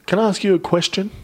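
lightly voiced pushy charity guy; mock pushy question. Charity guy stopping them at Coles, mock pushy question. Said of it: "he literally just goes".

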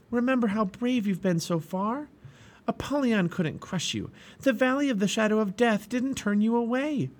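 a hopeful friend coaxing patience; gentle young pilgrim. Acted.

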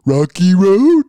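loud, put-on movie character voice; Slor from the Goonies. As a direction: blurt it out as a movie impression.

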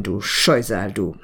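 tender murmur. Acted. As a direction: forgive him with a loving murmur. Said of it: "zärtlich".

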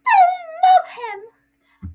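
high, loud gushing wail; Aunt Petunia. Acted. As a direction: gush like a doting mother. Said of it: "it's Aunt Petunia".

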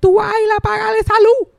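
very high, loud scolding order; abuela gritona. Acted. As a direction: play grandma yelling across the house. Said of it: "con mi abuela diciendo Fabián". (abuela mandando a gritos, voz muy aguda)

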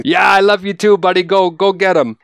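high, loud, excited cheer; cheering along with the record as a kid, excited shout. Retold or imitated. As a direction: yell it like a kid cheering him on. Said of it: "I'd be cheering".

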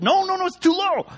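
high, insistent sibling bossing; pissy kid. Retold or imitated. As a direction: correct your brother in a high, fussy voice. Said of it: "they're getting super pissy".

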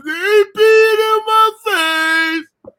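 slow, high, loud wail; whining sister. Retold or imitated. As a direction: drag it out like a wronged little sister. Sister crying out.

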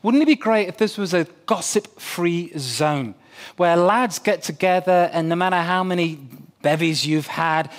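ordinary talk, nothing marked

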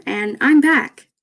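announcing a return. Real, not acted. Podcaster welcoming herself back, slow and proud. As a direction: say it like a grand entrance, stretched out. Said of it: "why do I keep on saying that".

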